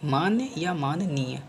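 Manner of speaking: मान्य या माननीय said slowly and clearly; slow dictation. Shown as a demonstration. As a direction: dictate it deliberately. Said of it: "माननीय नहीं".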